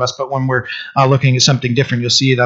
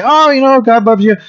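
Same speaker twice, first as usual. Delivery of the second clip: imitating a syrupy well-wisher, much higher; mock sugary comfort; 7 semitones higher than usual. Preacher mocking shallow comfort, in a sugary higher voice. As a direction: gush it like empty comfort, pitched up high. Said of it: "I don't want somebody to say".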